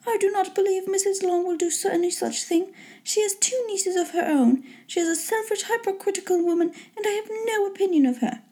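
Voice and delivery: high, soft, indignant mother voice; high-pitched matronly complaint; disapproving mother. A narrator's reading. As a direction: voice a fussy mother running someone down.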